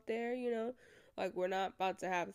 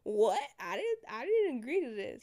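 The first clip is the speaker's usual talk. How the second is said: incredulous what; 3 semitones higher, 5 dB louder than usual. Real, not acted. Incredulous outburst.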